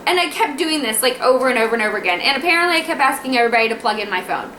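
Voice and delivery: ordinary talk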